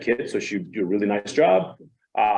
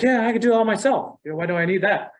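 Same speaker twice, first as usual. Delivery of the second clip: cocky brush-off; 8 semitones higher than usual. Retold or imitated. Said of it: "It's like".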